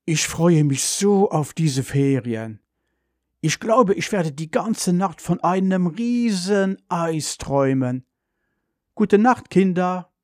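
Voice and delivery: drawn-out, sleepy mother's voice rising high; sleepy, yawning. A narrator's reading. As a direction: say a drowsy, dreamy goodnight. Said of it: "Mutter gähnt und sagt". (schläfrig und gähnend, gedehnt)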